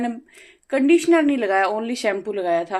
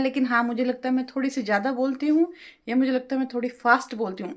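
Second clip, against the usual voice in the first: normal talking voice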